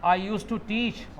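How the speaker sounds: slow, even words for students to repeat; teacher dictating to students; slow model sentence. Shown as a demonstration. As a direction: draw it out for the class to copy. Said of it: "वापस बोलो।".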